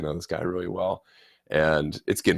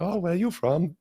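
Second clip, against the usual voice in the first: high-pitched mimicked German accent; broken-English German accent; 12 semitones higher than usual. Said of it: "a little bit broken English".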